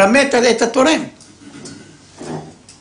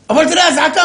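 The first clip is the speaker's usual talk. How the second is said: higher, louder, urgent plea; alarmed wife; 10 semitones higher, 5 dB louder than usual. Retold or imitated. Wife pressing husband about the siren, urgent and louder. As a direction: push him, the siren is on.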